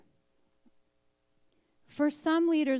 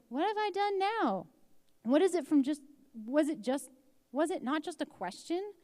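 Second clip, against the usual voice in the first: defensive younger brother; 6 semitones higher than usual. Acted. Younger brother answers angry sibling.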